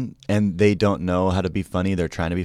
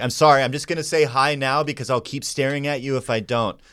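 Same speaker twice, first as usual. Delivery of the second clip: higher-pitched awkward apology; pitched-up nervous fan voice; starstruck stranger; 7 semitones higher than usual. Retold or imitated. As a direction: imitate an awkward admirer introducing himself. Quoting starstruck man across restaurant.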